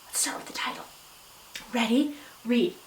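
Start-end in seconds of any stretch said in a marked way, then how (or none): 0.1-0.8 hushed whisper to the class. Real, not acted. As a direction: drop to a whisper before the reading starts. Teacher hushes class before reading.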